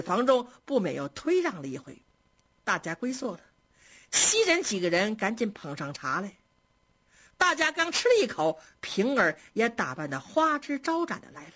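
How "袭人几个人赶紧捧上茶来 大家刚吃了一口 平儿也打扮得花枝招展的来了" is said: plain reading voice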